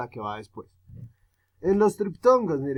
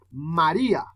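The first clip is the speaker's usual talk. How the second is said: "María" with a punched, raised I; stress on I. Shown as a demonstration. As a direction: lean on the I in "María", let the A drop. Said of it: "suena más duro la I".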